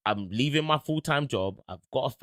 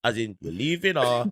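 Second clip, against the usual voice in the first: ordinary talk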